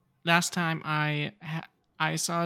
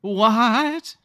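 sudden loud high shock; shocked what; 7 semitones higher, 9 dB louder than usual. Real, not acted. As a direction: blurt it out in disbelief. Shocked at the dog claim.

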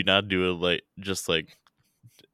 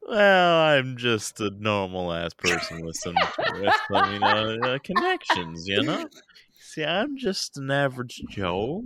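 mock modesty; 5 semitones higher than usual. Feigning modesty, laughing, high voice.

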